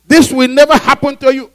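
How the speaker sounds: loud, high, forceful protest; Peter's reprimand. Acted. Peter refusing to let Jesus face the cross, loud protest. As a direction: forbid it at full voice. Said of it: "and reprimanded him".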